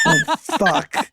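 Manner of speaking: high laughing curse; realizing his mistake, laughing high; laughing oh fuck. Retold or imitated. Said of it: "And I was like".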